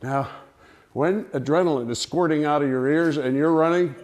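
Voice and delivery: ordinary delivery, nothing marked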